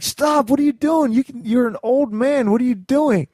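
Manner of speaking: high, pleading shout at dad; retold desperate yell. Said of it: "I was just like".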